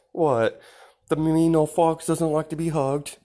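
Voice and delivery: mock teasing voice. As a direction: poke fun at the grumpy fox. Teasing the fox character.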